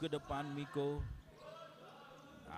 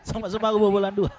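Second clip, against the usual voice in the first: excited; 4 semitones higher, 10 dB louder, much faster than usual. Real, not acted. Excited score remark.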